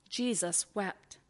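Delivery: quiet, grave and drawn out; a hushed, reverent Jesus wept; hushed solemn. Acted. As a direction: let the two words sink in softly. Solemn refrain repeated.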